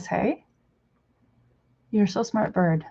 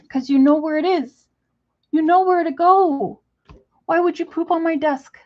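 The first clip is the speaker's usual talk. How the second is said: exasperated scolding; 6 semitones higher than usual. Real, not acted. Scolding bird.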